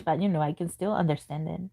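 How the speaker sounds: ordinary talk, nothing marked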